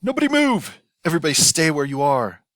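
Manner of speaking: high, loud panicked command; shouted. Acted. Hu rushes into the burning kitchen, shouting orders. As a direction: freeze everyone with a frantic yell. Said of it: "Mister Hu shouted".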